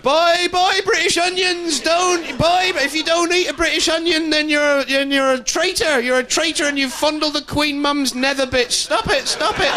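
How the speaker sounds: very high, shrill-pitched mock farmer pleading; hysterical farmer. Farmer begging shoppers to buy British onions, in a hysterical high voice. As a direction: screech like a farmer losing his mind. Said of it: "farmers going".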